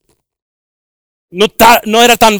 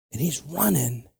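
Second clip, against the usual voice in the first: ordinary talk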